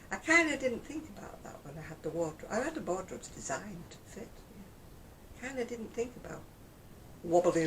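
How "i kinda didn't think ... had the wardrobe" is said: usual speaking voice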